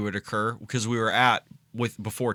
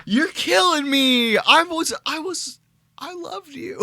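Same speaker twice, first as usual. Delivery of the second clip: mock heartbroken wail; 12 semitones higher, 11 dB louder, slower than usual. Mock breakup plea to co-host.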